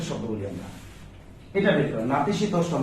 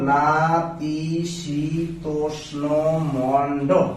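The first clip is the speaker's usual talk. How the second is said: নাতিশীতোষ্ণ dragged out slowly while writing, Mondal lifted; slow dictation; much slower than usual. Shown as a demonstration. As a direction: sound it out as you write it. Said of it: "দেখেন আমি কিভাবে লিখলাম". (লিখতে লিখতে ধীরে উচ্চারণ)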